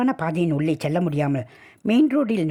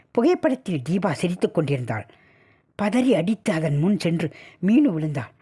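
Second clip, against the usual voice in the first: usual speaking voice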